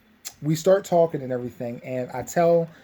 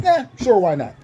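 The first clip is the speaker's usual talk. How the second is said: carefree Maze voice; 7 semitones higher, 6 dB louder than usual. Retold or imitated. Maze casually agrees.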